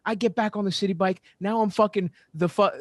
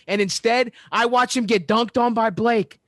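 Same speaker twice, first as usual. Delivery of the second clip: indignant rant; 6 semitones higher, 4 dB louder than usual. Real, not acted. Ranting about his team losing.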